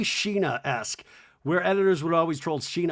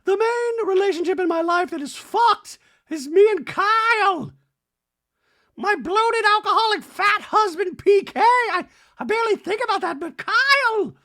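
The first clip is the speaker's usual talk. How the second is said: much higher, louder Dorit voice; hammed-up Dorit venting; mock Dorit; 6 dB louder than usual. Host voicing Dorit pouring out her troubles, high. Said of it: "She's like".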